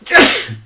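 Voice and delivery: loud sudden sneeze; a sharp real sneeze; sneezing. Real, not acted. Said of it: "Desculpa pelo espirro".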